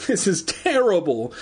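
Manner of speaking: high, mildly acted cry of dismay; cried out. A narrator's reading. Girl clutching her friend's shoulder, high cry of dismay. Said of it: "she cried".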